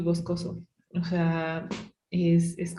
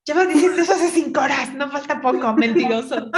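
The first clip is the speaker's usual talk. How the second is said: higher, louder and fast, half laughing; mock exasperated snap; 8 semitones higher, 4 dB louder than usual. Exhausted hiker scolding her guide, mock furious. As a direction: tell off the liar guide, hammed up. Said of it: "la más insoportable del mundo".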